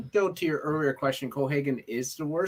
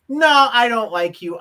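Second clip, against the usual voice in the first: villain sneer; 9 semitones higher, 8 dB louder than usual. Retold or imitated. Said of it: "He's like".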